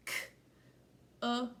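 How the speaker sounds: K-uh-k split into sounds; segmented sounding out. Shown as a demonstration. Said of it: "their other sound".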